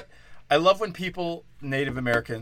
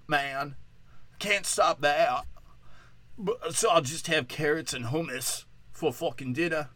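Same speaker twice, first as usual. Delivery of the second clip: higher, drawling hipster voice sliding into British; muddled hipster accent; 4 semitones higher than usual. Acted. Hipster complaining about dieting, muddled put-on accent. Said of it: "what accent I'm doing".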